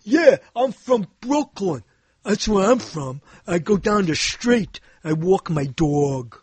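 put-on Brooklyn accent; mock Brooklyn talk, slowing at the end. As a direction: play a Brooklyn local answering back. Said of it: "Frank, where you from? Brooklyn?".

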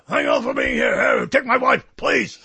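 hammed-up ranting comic; Alex Jones. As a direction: bark old one-liners as a raspy ranter. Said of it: "Alex Jones as a stand-up, doing stand-up, doing Henny Youngman".

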